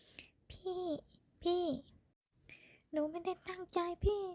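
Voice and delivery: high, keening lament; woman wailing over the man she shot; wailing grief. A narrator's reading. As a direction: cry over his body. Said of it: "เสียงผู้หญิงร้องไห้อย่างคร่ำครวญ".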